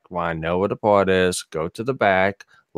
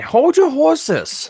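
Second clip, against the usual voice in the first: high, drawn-out mock English accent; badly put-on English voice, stretched out slowly; loud, squeaky stab at an English accent; attempted English accent; 5 dB louder, much slower than usual. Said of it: "trying to do an English accent".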